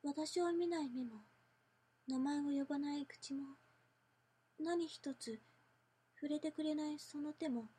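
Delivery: low, soft, creepy obsessive whisper-like voice; yandere. Shown as a demonstration.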